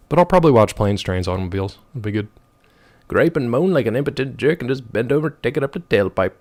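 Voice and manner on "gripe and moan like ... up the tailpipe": a hint of a gruff movie-character read; reading with a movie-quote flair. Streamer reading a chat message like a movie quote. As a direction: read it like a line from a film. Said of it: "Why does that sound like a movie quote".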